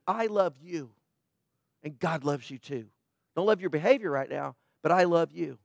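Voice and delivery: soft, low and hushed; like calming an angry teen at the door; tender parent. Retold or imitated. Parent tells angry child love.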